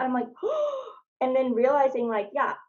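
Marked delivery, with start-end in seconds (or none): 0.3-0.9 sharp high gasp of realization; a quick, high startled gasp. Retold or imitated. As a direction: gasp as it hits you. Sudden realization reading survey.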